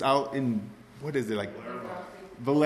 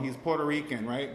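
normal talking voice

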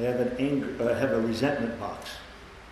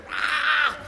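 sudden high loud scream of rage; 14 semitones higher, 6 dB louder than usual. Retold or imitated. Driver yells out the window in rage, high loud scream. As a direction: let out a burst of road rage.

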